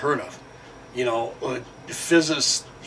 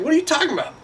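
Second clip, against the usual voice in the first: much higher hammed-up voice; mock coworker; 13 semitones higher, much faster than usual. Lazy coworker dodging the warning, high mocking voice. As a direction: play him dumb, way up high. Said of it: "He's like".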